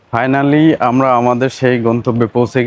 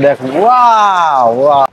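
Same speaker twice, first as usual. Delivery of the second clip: awed wow; 3 dB louder, slower than usual. Real, not acted. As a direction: marvel at the waves. Awed by river waves.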